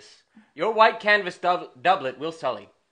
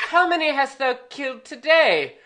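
Hotspur's wife; 10 semitones higher, 3 dB louder than usual. Retold or imitated. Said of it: "she says".

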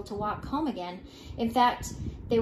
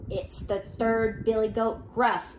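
plain reading voice

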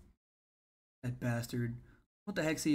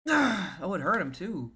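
plain reading voice

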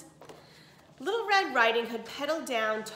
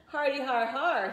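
mock laugh; 4 semitones higher, 4 dB louder than usual. A narrator's reading. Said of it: "he laughed wildly".

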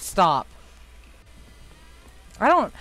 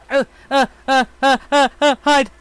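frightened, stuttering uh's, loud and high; panicking, barely getting words out; panicked stammer; 7 semitones higher, 10 dB louder than usual. Real, not acted. Panicked when something appears in game.

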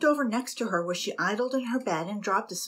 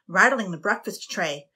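ordinary delivery

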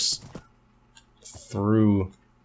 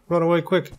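higher, more frantic than before; panicked high yelp; 8 semitones higher than usual. Pretend. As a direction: run away, voice jumping up high. Fleeing a mob in game.

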